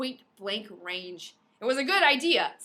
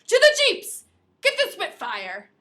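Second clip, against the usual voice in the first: shouted orders; 9 semitones higher, 11 dB louder than usual. Acted.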